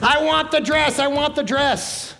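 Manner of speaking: put-on high, whiny child wailing for a dress; mock tantrum, high and whiny; screaming little girl. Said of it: "screaming at the top of her lungs".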